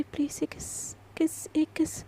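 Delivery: soft breathy plea for a kiss, barely voiced; whispered kiss request to her husband; pleading whisper. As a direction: beg in a near whisper, close and intimate.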